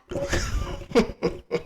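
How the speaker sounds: soft breathy laugh fading out; real laugh. Real, not acted. Reviewer laughing at the director's press-tour look, soft laugh. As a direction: let a quiet laugh slip out.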